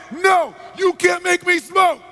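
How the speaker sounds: high, slow, loud refusal; full-voiced defiant outburst; defiant scream. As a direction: scream back in final rebellion. Client finally rebels.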